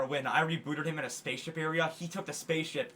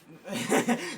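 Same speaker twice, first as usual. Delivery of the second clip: sudden high laugh; burst of laughter; 7 semitones higher, 4 dB louder than usual. Real, not acted. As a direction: laugh out loud, caught off guard. Bursts out laughing mid-story.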